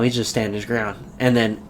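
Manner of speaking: ordinary talk, nothing marked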